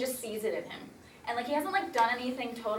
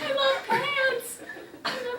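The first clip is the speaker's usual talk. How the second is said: laughing; 13 semitones higher, slower than usual. Real, not acted. Silly pants joke, laughing.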